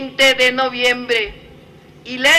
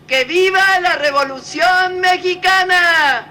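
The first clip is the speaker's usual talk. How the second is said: rally cry, each viva hammered out; ranting dictator speech; 4 semitones higher than usual. Real, not acted. Closing patriotic cries of viva.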